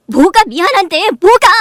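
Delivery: high, loud retort; furious challenge. Acted. Wife to her apologizing husband, furious. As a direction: throw the apology back at full voice.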